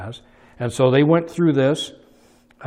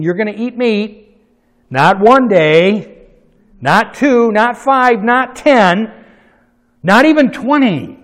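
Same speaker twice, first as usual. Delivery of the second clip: louder and higher on each not; building emphatic rebuke; 7 semitones higher, 9 dB louder, slower than usual. Retold or imitated. God rebuking the complaining people, rising loud emphasis. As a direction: hammer each number harder.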